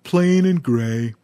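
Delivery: slow glum elephant voice. A narrator's reading. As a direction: drag it out, sadly. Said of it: "Dumphy said".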